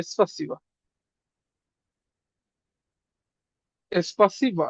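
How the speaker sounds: "স্পাসিবা" repeated carefully, syllable by syllable; pronunciation demo. Shown as a demonstration. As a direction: say "স্পাসিবা" slowly for students to copy. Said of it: "আর মতন উচ্চারণ হবে". (স্পাসিবা ধীরে উচ্চারণ)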